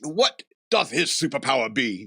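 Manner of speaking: booming archaic Thor, first line shouted; mock Thor voice. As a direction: ham up Thor's old-fashioned speech. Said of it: "Do you have to talk like that all the time".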